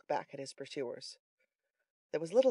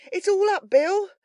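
very high, loud cry; cried out; 11 dB louder than usual. A narrator's reading. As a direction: call the alarm to your partner. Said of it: "cried Toby".

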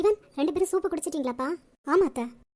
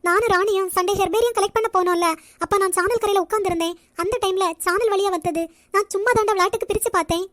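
loud, raised-pitch denial; defensive protest; 3 semitones higher, 7 dB louder than usual. Acted. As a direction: protest your innocence loudly to a sibling. Sister denies sibling's accusation.

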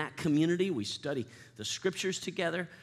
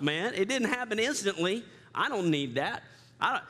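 mock impatience; 8 semitones higher than usual.

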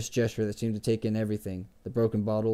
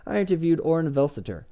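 higher-pitched, insistent claim; firm; 6 semitones higher, 4 dB louder than usual. A narrator's reading. Chronicler boasting of a past interview, firm and higher. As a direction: assert your credential firmly. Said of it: "Chronicler said firmly".

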